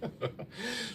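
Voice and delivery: a bright laugh that rises high; high burst of laughter. Real, not acted. Host greeting her live viewers with a high real laugh.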